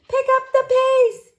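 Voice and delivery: very high, loud yell; yelled order. A narrator's reading. Josie James urges on people stacking sandbags, yelling. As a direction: yell it to hurry everyone along. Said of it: "yelled Josie James".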